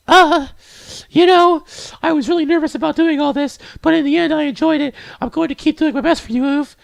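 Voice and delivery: put-on very high, earnest girlish falsetto; high, sweet character voice; high-voiced game girl. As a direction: speak as an eager little anime girl.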